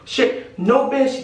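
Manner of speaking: normal talking voice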